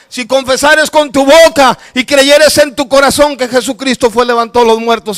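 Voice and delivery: fervent retold shouting; shouted recitation. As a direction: shout the verse out. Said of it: "yo lo gritaba y decía".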